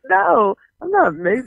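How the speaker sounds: indignant retelling, drawing out the no; offended retort. Retold or imitated. Teller recalls being asked if she's mixed, offended. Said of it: "I was highly offended".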